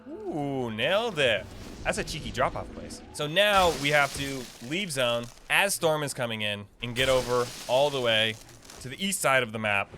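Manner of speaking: ordinary delivery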